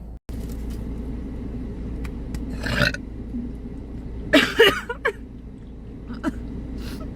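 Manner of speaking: high, airless laughing fit; high breathless laugh. Real, not acted. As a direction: laugh helplessly through the burn. Laughing after spicy bite.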